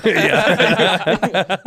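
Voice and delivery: loud, high word inside a laugh. Real, not acted. Comedian agreeing with the director's jab, laughing. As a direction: agree while cracking up. Laughing at his own story.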